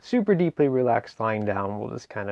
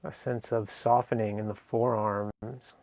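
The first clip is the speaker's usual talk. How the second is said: guided meditation. Acted. Meditation teacher guiding listeners through a seated relaxation, guided meditation. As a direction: ease them gently through each phrase, leaving space between.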